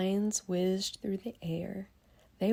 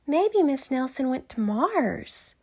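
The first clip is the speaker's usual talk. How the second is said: loud, higher earnest guess; imaginative kid; 6 semitones higher, 8 dB louder than usual. Acted. Another kid guessing wildly, loud and higher. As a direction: suggest it wide-eyed. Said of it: "said another kid".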